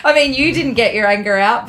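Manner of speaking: ordinary talk, nothing marked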